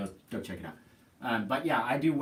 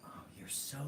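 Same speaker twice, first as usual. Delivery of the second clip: whisper; 16 dB quieter than usual. Real, not acted. Whispered aside about candy bars.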